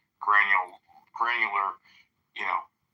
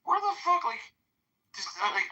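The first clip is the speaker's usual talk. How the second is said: much higher, mock disbelief at the staff; retold exasperation. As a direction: squeak out the outrage like you can't believe it.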